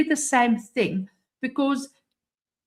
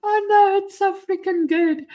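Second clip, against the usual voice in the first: high, loud burst of delight; delighted squeal; 10 semitones higher, 3 dB louder than usual. Real, not acted. Streamer reacting to the generated golf gear, squealing with delight. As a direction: gush over the picture like it's a gift.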